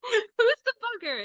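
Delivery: high, slow, laughing question. Real, not acted. Teasing the spirit box that called her a bugger, laughing. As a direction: laugh through it, delighted.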